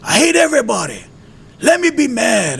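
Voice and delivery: high, loud furious scream. Retold or imitated. Man screaming at God on the road, high and loud. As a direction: scream it at the sky, out of control. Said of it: "I'm screaming in the middle of the road".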